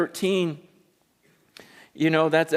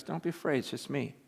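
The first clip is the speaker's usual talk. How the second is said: softer, hushed and calming; Jesus quietly soothing his scared disciples; gentle reassurance; 4 semitones lower, 10 dB quieter than usual. Retold or imitated. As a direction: settle frightened friends down quietly. Jesus calms terrified disciples.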